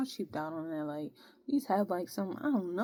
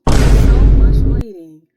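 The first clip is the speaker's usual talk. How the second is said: much louder, slow fed-up complaint; exasperated shout; 26 dB louder, slower than usual. Real, not acted. Vlogger venting about MEPS waiting, slow loud exasperation. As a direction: let it out slowly at full volume.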